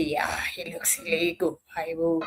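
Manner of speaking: soft, whispered quote of a sneering judge; mock whisper. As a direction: whisper it like sizing someone up. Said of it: "do you just look and say".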